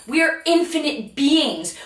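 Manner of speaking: ordinary talk, nothing marked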